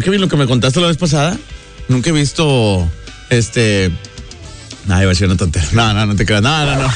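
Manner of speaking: ordinary talk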